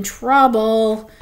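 loud, drawn-out word of dread; ominous and drawn out; foreboding. A narrator's reading. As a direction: stretch it out like trouble is coming. Bean's worried thought as Zuzu leaves.

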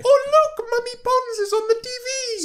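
put-on high squeaky kid voice; childish voice. Mocking child voice at TV.

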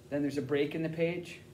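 usual speaking voice

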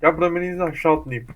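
loud, calling a friend over. Real, not acted. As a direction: call him over loudly.

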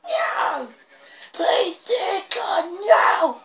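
high, drawn-out wailing plea; mock despair. Gamer replaying how losing the upgrade felt, mock-despairing wail. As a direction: wail as if all is lost, hammed up. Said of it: "That's what I felt like".

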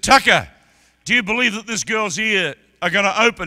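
shouting the question out to Tucker; retold loud call. Retells calling Tucker on platform.

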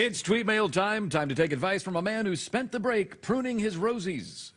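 segment announcer. Acted.

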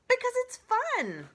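gleeful opossum. Acted. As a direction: exclaim it with playful glee. Opossum defends pretending.

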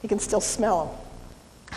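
normal talking voice

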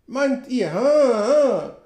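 high, loud "Ha, ha"; a scornful scout's laugh; mocking laugh. A narrator's reading. Sam mocks the narrator's idea with a loud laugh.

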